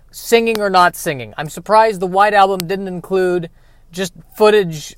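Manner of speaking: ordinary talk, nothing marked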